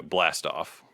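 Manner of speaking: usual speaking voice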